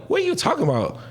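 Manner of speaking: high-pitched retold confusion; baffled reply. Sailor stopped in the hallway, high baffled reply. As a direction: pitch jumps up in puzzled protest.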